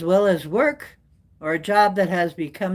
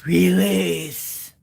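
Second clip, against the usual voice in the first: drawn-out, mock-sexy reveal; 4 dB louder than usual. Reading out the card's name, playfully sexy. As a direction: let the word slide out seductively, playfully. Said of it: "it sounds kinda sexy".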